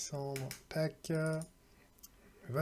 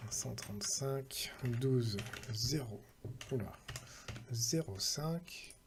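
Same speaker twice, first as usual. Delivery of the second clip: slow, quieter numbers read out one by one while typing; slow dictation; 5 dB quieter, slower than usual. Real, not acted. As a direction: spell out each number slowly as you write it down. Repeats numbers while typing them.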